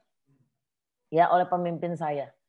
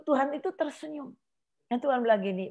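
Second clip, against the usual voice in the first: ordinary talk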